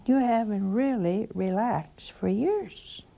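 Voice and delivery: ordinary talk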